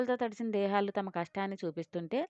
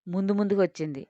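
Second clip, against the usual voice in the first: plain reading voice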